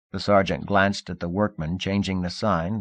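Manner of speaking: ordinary delivery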